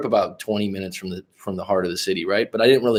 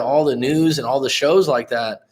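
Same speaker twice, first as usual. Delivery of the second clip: ordinary talk, nothing marked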